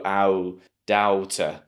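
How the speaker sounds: "ow" then "doubter" as dowl-tuh; students' mistake. Retold or imitated. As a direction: squeeze the vowels into "ow" in "doubter". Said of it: "squeeze those two vowel sounds together".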